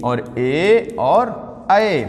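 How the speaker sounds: ए and ऐ stretched very slowly; very slow vowel demo. Shown as a demonstration. Teacher repeats the vowel pair for the class, drawn out. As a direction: draw out ए, then ऐ. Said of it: "एक आपने देखा था छोटी ई और बड़ी ई".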